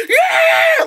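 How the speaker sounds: high loud whoop; cheering yeah. Retold or imitated. Fans in back corner cheering, high whoop. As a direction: cheer like a fan.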